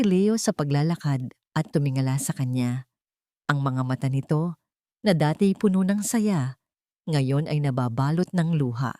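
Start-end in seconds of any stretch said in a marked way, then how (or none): none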